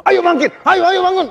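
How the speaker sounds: very high, urgent pep shout; pushy motivator. Acted. Motivator ordering a depressed person to get up, pushy. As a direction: goad them up in a high, pressing voice. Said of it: "itu disuruh".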